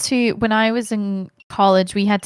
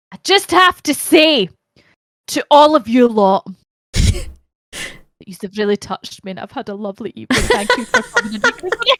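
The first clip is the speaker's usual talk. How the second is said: drag queen farewell; 3 semitones higher than usual. Retold or imitated. Guest imitating a drag queen thanking the drama class. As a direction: give the drag queen's grand thank-you. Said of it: "the drag queen was just like that".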